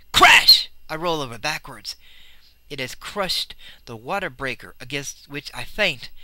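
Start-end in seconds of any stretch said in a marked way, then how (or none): 0.1-0.7 sudden loud burst, voiceless and sharp; crash shouted. A narrator's reading. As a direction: bark out the crash like a blow.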